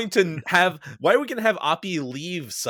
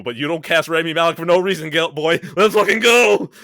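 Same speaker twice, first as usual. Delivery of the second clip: hyped; 7 dB louder than usual. Retold or imitated. Hyping Rami Malek's courtroom entrance.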